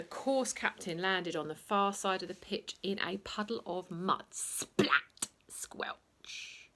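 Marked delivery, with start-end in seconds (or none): 4.3-5.3 sharp splat. A narrator's reading. Sound effect. 5.5-6.7 soft squelch. A narrator's reading. Sound effect.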